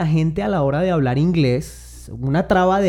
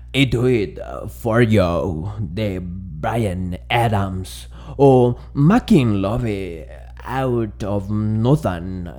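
deep, slow late-night radio announcer with a put-on gringo accent; fake radio DJ accent; 6 semitones lower, much slower than usual. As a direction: croon the song titles like a sultry night DJ. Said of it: "ponen un acento todo postizo". (locutor nocturno grave y lento con acento gringo postizo)

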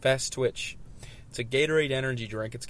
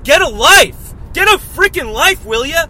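much higher and much louder mock yell at the honker; retold yell; 13 semitones higher, 13 dB louder than usual. Retelling how he yelled at a honking driver, mock furious yell.

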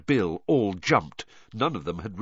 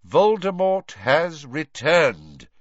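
slow, loud, raised declaration; "Voldemort has returned", each word weighted; emphatic; 6 semitones higher, 6 dB louder, slower than usual. A narrator's reading. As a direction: state VOLDEMORT HAS RETURNED slowly and forcefully. Dumbledore firmly repeats the truth.

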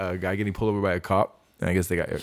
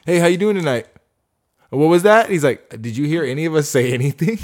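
lightly voiced cop, higher and louder; imitating the cop; 6 semitones higher, 6 dB louder than usual. Retelling a traffic stop video, a hint of a loud cop. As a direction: play the pushy cop questioning the driver. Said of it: "the cop was just like".

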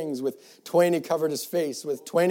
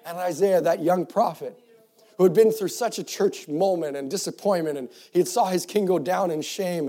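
ordinary delivery

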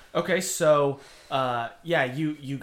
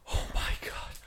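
whisper; 11 semitones higher, 7 dB louder than usual. Acted. Frightened NPC, unvoiced delivery.

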